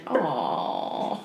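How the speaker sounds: low, drawn-out "aw"; condescending pitying aw; bless-your-heart pity. Shown as a demonstration. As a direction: coo it with mock sympathy. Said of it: "a long sentence of saying".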